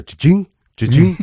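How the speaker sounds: spring each sound upward like a pogo stick; mimicked pogo stick bouncing; imitated bouncing noise. Imitating bounces passing onlookers.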